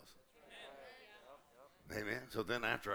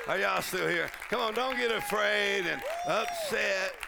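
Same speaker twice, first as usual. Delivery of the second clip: loud, high, slow preaching; full force preaching; 8 semitones higher, 9 dB louder, slower than usual. Acted.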